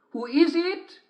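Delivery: very high sing-song falsetto as the wolf; a wolf pretending to be sweet, sung up high; wolf in disguise. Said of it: "sang out the wolf".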